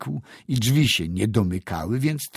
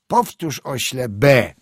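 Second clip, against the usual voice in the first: louder, sharper repeated order; impatient command; 4 semitones higher, 7 dB louder than usual. A narrator's reading. Teacher orders the silent pupil to repeat, impatient. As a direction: demand it forcefully, raised voice.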